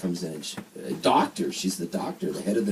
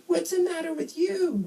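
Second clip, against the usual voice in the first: put-on high-pitched New Jersey accent; squeaky-high Jersey nurse impression. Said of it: "this New Jersey nurse in Princeton".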